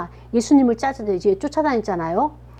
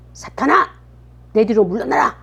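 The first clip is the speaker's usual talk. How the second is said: acted rebuke, pitched up on the call; stern scolding. Jesus to his beloved chief disciple, scolding sternly. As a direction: call out sharply, then command. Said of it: "야단을 치는 거예요".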